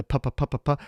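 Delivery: repeated puffed "pu" sounds into the mic; popping plosives. Shown as a demonstration. Reviewer tests the mic's plosives, popping "pu" repeatedly. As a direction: pop the p's in a quick string. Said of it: "And the plosives and the".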